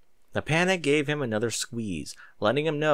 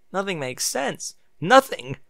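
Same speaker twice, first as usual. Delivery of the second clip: panicky; 6 semitones higher, 3 dB louder than usual. A narrator's reading. Bucky's panic attack.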